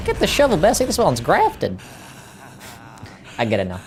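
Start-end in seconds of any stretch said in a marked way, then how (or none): 0.1-1.8 high, hammed-up Southern holler; excitable Southern twang, pitched way up; American Southern accent. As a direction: call out like a backwoods Southerner. Redoes viewer line in Southern voice.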